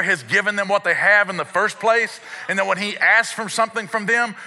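high, driving sermon delivery; impassioned preaching. Real, not acted. Preacher teaching a congregation about supply, impassioned preaching. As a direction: proclaim it to the congregation, high and forceful. Preaches the point at full force.

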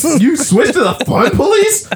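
high-pitched disbelieving mock outrage; incredulous. [name] to Arnold on switching to the British, incredulous. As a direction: squeal it as a baffled question. Acted shock at a side switch.

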